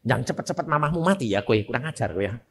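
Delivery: mock grumble as an offended mother; softly imitating an annoyed mother; scolding mother. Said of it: "ibu-ibu ... bilang".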